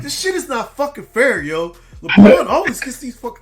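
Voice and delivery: high, loud mock complaint with a laugh; whine-yell about the unfairness, half laughing; retold yelling. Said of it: "he was just yelling through the phone".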